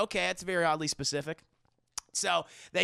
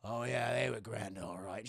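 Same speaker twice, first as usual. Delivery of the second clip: awkward quiet agreement; 7 semitones lower, 7 dB quieter than usual. Retold or imitated. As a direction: lower and softer, unsure. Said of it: "She goes".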